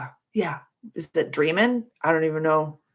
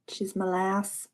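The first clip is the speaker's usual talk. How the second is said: ordinary talk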